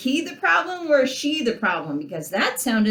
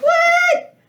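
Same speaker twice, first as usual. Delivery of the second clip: high, loud squeal of disbelief; shrill-free, just a squeaky outraged What; mock disbelief; 12 semitones higher, 4 dB louder, slower than usual. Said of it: "And I'm like".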